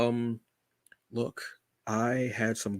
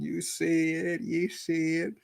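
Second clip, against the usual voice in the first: higher, hyped comedian catchphrase voice, a self-declared bad imitation; Tony Baker impression; 7 semitones higher, slower than usual. Showing off his purple shirt to viewers in a mock comedian voice. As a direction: pitch it up and sell the catchphrase like a comic bit. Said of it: "In my best Tony Baker impression".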